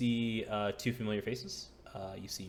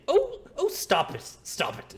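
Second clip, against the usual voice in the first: woman's voice; 9 dB louder than usual. Acted. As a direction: cry out as a woman telling off an animal. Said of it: "you hear a woman's voice say".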